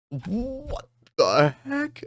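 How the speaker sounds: high, slow stretched what the heck; shocked disbelief. Real, not acted. Reader shocked by a wounded Lucci. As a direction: gasp it out in disbelief.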